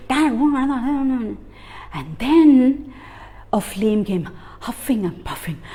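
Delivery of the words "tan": ordinary delivery, nothing marked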